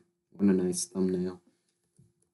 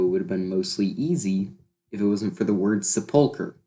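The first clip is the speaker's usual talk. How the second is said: ordinary delivery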